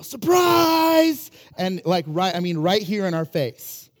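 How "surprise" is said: put-on high, loud yelled surprise, like a little boy. Toddler leaps at napping parents with a high, loud yelled surprise. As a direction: jump out and yell it like a toddler. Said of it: "he would yell".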